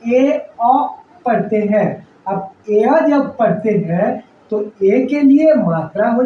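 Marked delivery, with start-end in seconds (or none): 0.0-0.7 ए अ said very slowly; ए and अ drawn out, one by one; slow teaching ए अ; slow vowel demo. Shown as a demonstration. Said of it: "इस ए को ए अ".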